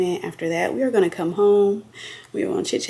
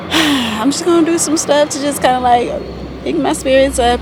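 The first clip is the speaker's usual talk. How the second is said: excited; 7 semitones higher, 7 dB louder than usual. Real, not acted. Upbeat vlog push.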